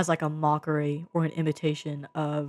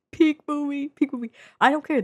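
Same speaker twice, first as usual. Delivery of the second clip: excited declaration; 10 semitones higher, 5 dB louder than usual. Real, not acted. Declaring film a masterpiece.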